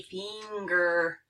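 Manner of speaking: "finger" with a stretched ee in the first syllable; long E vowel. Shown as a demonstration. Teacher showing the I pronounced like long E, drawn out. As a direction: draw out "finger" as feen-ger. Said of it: "pronounced like a long E".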